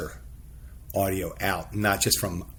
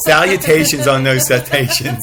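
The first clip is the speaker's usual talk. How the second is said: laughing; 3 semitones higher, 9 dB louder than usual. Real, not acted. Playful rhyme, laughing.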